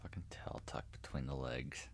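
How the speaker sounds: hushed, breathless aside; whisper. Real, not acted. Cohost whispering a mocking aside about the fleeing villain. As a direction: lean in and whisper it.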